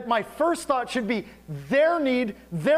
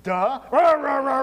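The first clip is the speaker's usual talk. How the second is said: loud dopey mumbling; mock mumble; 4 dB louder than usual. Mocking a confused student staring at her plate, dopey grunt. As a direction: grunt like a lost farm boy. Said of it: "down at their plates and go".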